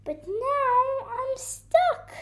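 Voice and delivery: high, slow little wail; high, drawn-out complaint; plaintive baby deer. A narrator's reading. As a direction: lament it as a stranded baby deer. Baby deer laments being stuck.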